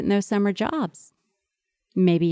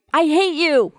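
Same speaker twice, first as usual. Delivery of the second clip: mock angry child; 7 semitones higher, 4 dB louder than usual. Child slams door, tells you.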